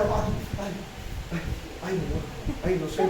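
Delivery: soft, low imitation of fretful dread; mock uneasiness. Preacher mimicking someone uneasy about fearing God, mock fretting. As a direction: act out hesitant unease under your breath. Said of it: "con un desasosiego".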